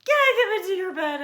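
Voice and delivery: high, loud, fast begging; eager little mouse. A narrator's reading. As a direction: plead quickly in a high voice. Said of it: "asked Little Mouse".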